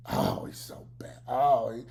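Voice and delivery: whispered mocking whine; mock whining. Mocking critics, whispered whine. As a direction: sneer at the whiners in a breathy mock. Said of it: "these Negroes on here".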